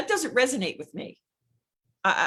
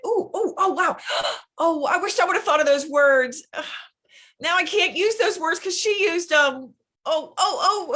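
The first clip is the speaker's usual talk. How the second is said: high, louder hammed-up gasps of envy; mock envious gasping; 7 semitones higher, 5 dB louder than usual. Coach mocking a jealous scroller, hammed-up envy. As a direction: gasp and moan like you're jealously scrolling someone's feed.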